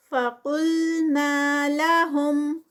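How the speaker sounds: فَقُلْنَا لَهُمْ slow, heavy ق and نا held two counts; Quran recitation. Shown as a demonstration. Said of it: "نا پہ ٹو کاؤنٹس".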